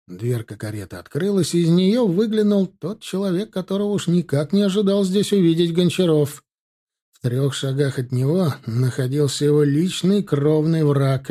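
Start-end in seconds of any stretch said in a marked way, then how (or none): none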